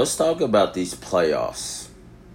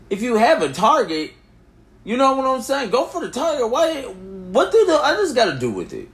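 much higher, pleading disbelief; exasperated high-pitched rant; 4 dB louder than usual. Real, not acted. Vlogger ranting at viewers in exasperated high voice. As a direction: throw it up high like you can't believe it.

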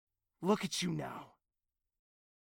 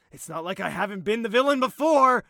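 loud, fast bitter outburst; angry outburst; 5 semitones higher, 10 dB louder, faster than usual. Acted. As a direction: hurl it out in anger. Outburst after quiet line.

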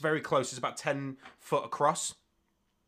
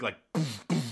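normal talking voice

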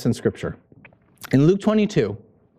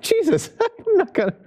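very high, squeaky mock disbelief; incredulous Peter. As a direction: protest in a laughing, far higher voice. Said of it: "It's like".

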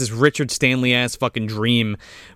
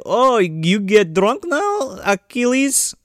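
slow, higher mock-Russian taunt; 6 semitones higher, slower than usual. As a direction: needle him in a put-on Russian accent. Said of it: "'Cause he's Russian".

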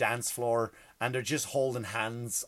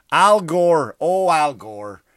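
mocking name call; 3 semitones higher, 10 dB louder, much slower than usual.

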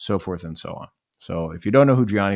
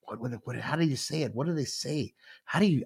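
much softer, muttered search for the word; muttering to himself; 4 semitones higher, 6 dB quieter than usual. Retold or imitated. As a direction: mumble under your breath, trying to remember. Searching memory for Italian phrase.

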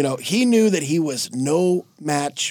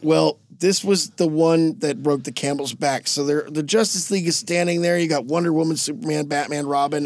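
ordinary talk

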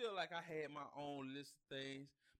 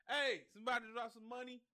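higher, louder mock call to passers-by; 5 semitones higher, 5 dB louder than usual. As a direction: call out as if asking around. Acted calling out to passers-by.

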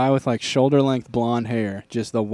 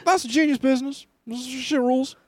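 lightly voiced high drunk hype guy; a hint of tipsy business bro hyping a lemonade stand; drunk Gary Vee; 12 semitones higher than usual. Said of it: "Drunk Gary Vee".

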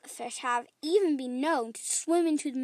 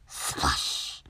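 unvoiced, airy splash; splash effect; 6 dB quieter than usual. Storyteller ends with the ocean, airy splash sound. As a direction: breathe out a long watery shh.

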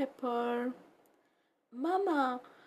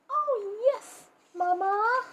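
eager puppy voice; 8 semitones higher, 5 dB louder than usual. Acted. Puppy answers Mama.

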